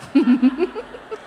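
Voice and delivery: short laugh at the punchline; amused laugh. Real, not acted. Preacher laughing at her husband's deadpan line. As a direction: let a laugh burst out.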